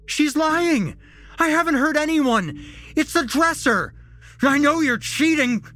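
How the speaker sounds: very high, loud accusing yells; frantic shouting. A narrator's reading. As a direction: shout wildly in your own defence. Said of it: "Jesse shouted".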